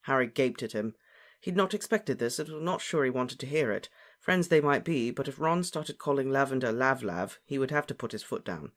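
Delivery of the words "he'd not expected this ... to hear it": usual speaking voice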